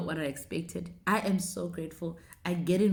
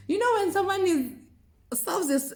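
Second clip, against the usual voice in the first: ordinary talk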